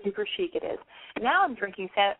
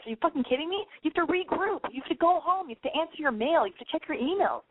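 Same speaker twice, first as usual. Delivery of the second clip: higher-pitched nagging pep talk; exasperated self-scolding; 7 semitones higher than usual. Acted. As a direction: list the chores in mock exasperation. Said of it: "you're like".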